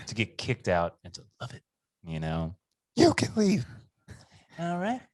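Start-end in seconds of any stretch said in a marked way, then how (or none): 2.9-5.0 high, cheery mock-polite exit; breezy sarcastic goodbye, pitched up; lightly mocking Larry. Reenacting getting kicked out happily.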